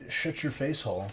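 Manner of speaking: ordinary talk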